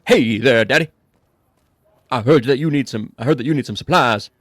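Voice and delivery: loud, shaky attempt at an [name] accent. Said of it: "that's an accent I can't do".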